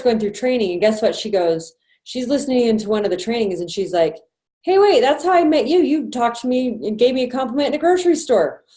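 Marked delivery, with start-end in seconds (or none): 4.6-8.6 mock surprised realization, higher and louder; excited recognition. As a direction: act out a sudden eureka moment. Recruit realizes how they met.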